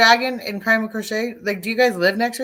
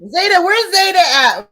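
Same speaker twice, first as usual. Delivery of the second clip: loud, slow call across the room; 8 semitones higher, 11 dB louder, slower than usual. Real, not acted. Streamer looking around the room for Zeta, calling out loud and slow. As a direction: call the pet's name loudly and slowly.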